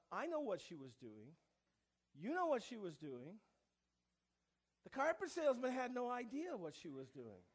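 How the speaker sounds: ordinary delivery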